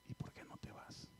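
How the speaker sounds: whispered very softly, almost no voice; suavecito. Retold or imitated. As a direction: murmur it low and gentle in a whisper. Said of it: "yo le digo suavecito, así suavecito". (susurrado suavecito)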